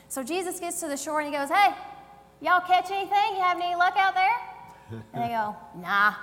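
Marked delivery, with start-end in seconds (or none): 1.5-4.4 loud, quick country twang called across the water. Acted. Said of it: "I did it with my country twang". 5.8-6.1 low, loud country one-word reply; short yelled-back answer in country twang. Acted. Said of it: "I did it with my country twang".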